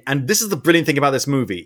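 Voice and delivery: usual speaking voice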